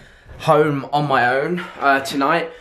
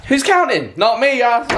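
playful high repeat; 7 semitones higher than usual. Shown as a demonstration. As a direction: toss "Who's counting?" up high, jokingly. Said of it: "who's counting, right?".

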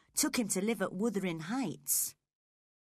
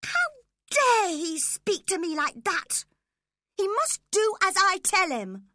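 indignant outrage; 11 semitones higher, 8 dB louder than usual. Acted. Indignant spoilt girl.

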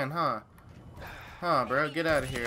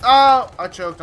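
frustrated shout; 8 semitones higher, 13 dB louder than usual. Real, not acted. Missed shot, frustrated.

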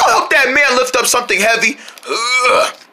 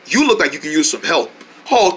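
usual speaking voice

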